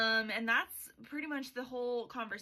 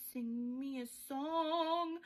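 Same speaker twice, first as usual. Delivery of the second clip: slow, higher, otherworldly demand; eerie metal voice; 4 semitones higher, much slower than usual. Retold or imitated. Quicksilver demanding a song from Saris, slow eerie voice. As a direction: make it sound like living metal.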